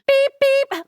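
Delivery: high, loud double beep; high-pitched electronic beep imitation; imitated robot beep. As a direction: beep like a sensor alert. Mimics sensor beeps in scripted lines.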